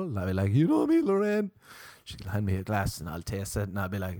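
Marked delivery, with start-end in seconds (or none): 0.5-1.5 very high, loud mock reply; playful catchphrase. Comedian answering Lorraine's drink offer, playful and very high. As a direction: push the catchphrase up into a squeal. Repeats his catchphrase, higher.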